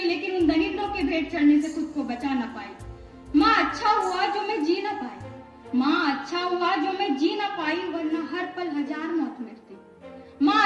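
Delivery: ordinary talk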